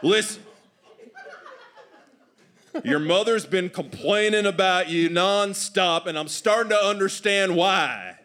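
high, exasperated Southern dad voice; stern Southern father. Acted. Father tells children of mother's complaints, exasperated Southern scolding. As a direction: scold them in a Southern accent.

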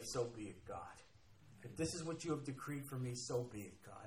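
hushed, low acted prayer; quiet submission. Believer accepts what God has decreed, quiet submission. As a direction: yield softly to God, voice dropped low.

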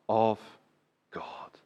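"God" 只用气声轻轻吐出; whisper. Shown as a demonstration. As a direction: breathe the word "God" as a whisper. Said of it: "whispered climax".

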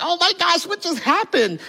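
high mock shock; child stunned after the spoon; stunned kid. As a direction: play the dazed child. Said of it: "you're just like".

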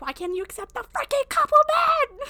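put-on shrill-high exasperated valley girl; giddy party girl. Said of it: "It's like".

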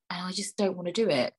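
usual speaking voice

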